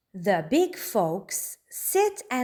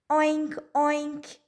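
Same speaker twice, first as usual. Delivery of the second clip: a touch high, mild oink; lightly voiced piggy grunt; playful pig noises read aloud; 7 semitones higher than usual. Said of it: "he grunts".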